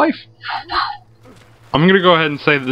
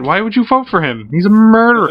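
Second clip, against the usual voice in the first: mock outrage; 5 semitones higher than usual. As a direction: call him out in mock shock.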